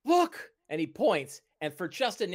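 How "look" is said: high, sudden exclamation; Bing. Acted. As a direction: call out a startled discovery. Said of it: "Bing says".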